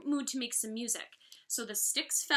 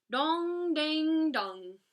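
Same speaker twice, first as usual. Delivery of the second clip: higher, ringing dong dang; playful chime noises; bottle chimes; 5 semitones higher, 4 dB louder, much slower than usual. A narrator's reading. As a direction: sing it like struck bottles. Playing on bottles.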